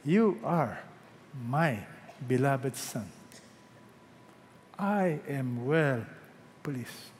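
low, soft, slow blessing; hushed, lingering words of approval; God's tender approval. Acted. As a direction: say it softly to a beloved child, with pauses. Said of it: "you know what else he will say".

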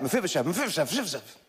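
rapid-fire tongue twister; hurried repeats that blur and fade at the end; really fast. Shown as a demonstration. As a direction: race through the tricky name, words tumbling together. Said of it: "three times really fast".

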